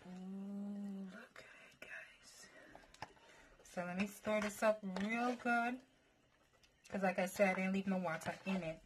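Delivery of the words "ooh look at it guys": hushed whisper to the viewers; awed whisper. Real, not acted. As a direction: breathe it out in awe. Awed look at the noodles.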